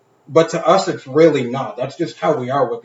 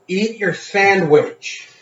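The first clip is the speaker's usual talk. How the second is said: higher, drawn-out plea; exasperated urging; 7 semitones higher, 4 dB louder, much slower than usual. Retold or imitated. Telling forgetful dad to eat his sandwich, exasperated. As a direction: stretch it out, spelling out the obvious.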